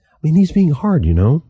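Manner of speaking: high, hurt protest; aggrieved youth. A narrator's reading. Youth protesting to Crow, high and sulky. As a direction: lift it up, sulky and stung. Youth complaining about Resolute.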